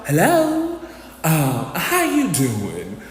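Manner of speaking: a hint of an angelic Southern belle greeting; imitating a Southern belle answering the phone. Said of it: "angelic voice of a Southern belle".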